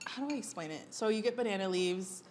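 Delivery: usual speaking voice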